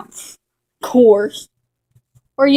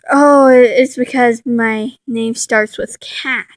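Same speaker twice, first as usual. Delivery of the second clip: ordinary delivery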